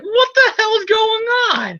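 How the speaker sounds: very high, frantic cry; hammed-up high-pitched outburst; mock bewildered yell. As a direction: squawk in comic total confusion. Acts bewildered Peter's outburst.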